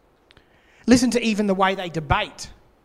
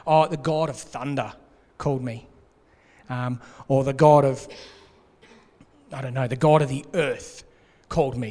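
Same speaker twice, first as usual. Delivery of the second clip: a hint of a grand announcement, lower; low mock boast; 4 semitones lower than usual. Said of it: "He's looking for something".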